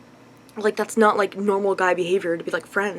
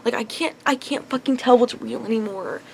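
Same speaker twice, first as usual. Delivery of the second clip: ordinary talk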